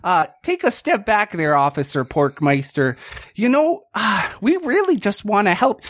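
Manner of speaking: buddy-buddy cop easing a suspect; Bortle's good cop voice. Acted. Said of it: "Bortle's just like".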